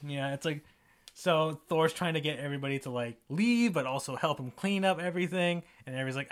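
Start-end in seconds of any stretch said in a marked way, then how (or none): none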